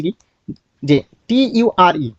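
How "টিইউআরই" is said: টিইউআরই spelled out very slowly letter by letter; slow teacher spelling. Shown as a demonstration. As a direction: drag each letter of টিইউআরই for the class. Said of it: "কি লিখে রেখেছি?".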